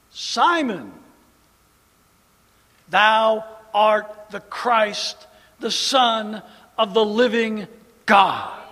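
loud, very slow declaration; Peter's bold confession; proclaimed confession. Acted. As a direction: ring it out like a creed.

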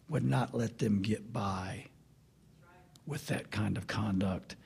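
hushed, low whispered warning. Real, not acted. Preacher warning of God's judgment in a low whisper. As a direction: drop to a solemn whisper.

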